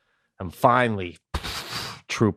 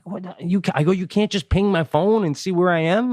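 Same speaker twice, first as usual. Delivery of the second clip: exasperated, pitched up high; high, exasperated rant; 8 semitones higher than usual. Retold or imitated. Stranded driver rants at the roadside assistance guy, high and exasperated. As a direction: sputter in high-pitched disbelief. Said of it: "I keep cursing".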